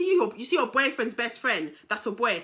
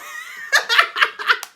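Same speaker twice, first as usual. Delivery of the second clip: high, loud burst of laughter; 6 dB louder than usual. Real, not acted. Storyteller amused by her past anger, high laugh. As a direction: crack up at your own story.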